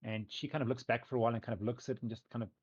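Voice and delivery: ordinary talk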